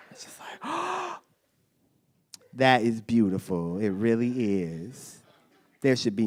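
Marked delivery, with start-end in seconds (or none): 0.6-1.1 mock awed gasp. Stranger awed by dad. 2.5-5.2 low, slow and softer, mock moved; hushed mock reverence. A stranger praising a dad at the park, in hushed mock reverence.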